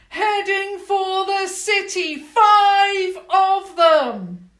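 very loud, high shout; shouted warning. Acted. Enormous goblin shouts alarm into chamber, loud shout. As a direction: yell the alarm across the chamber.

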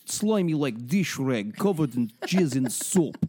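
slow hammed-up Russian accent. Parodying Putin describing the queen, comic Russian accent. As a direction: relish each word in a mock Russian accent. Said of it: "I love your accent".

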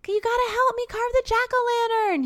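put-on high-pitched little boy voice; imitating a small child asking for help; little kid. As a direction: pipe it up high like a pleading kid. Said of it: "the little kid is like".

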